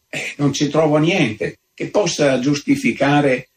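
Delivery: plain reading voice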